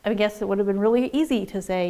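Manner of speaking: ordinary talk, nothing marked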